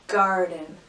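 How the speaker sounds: ordinary talk, nothing marked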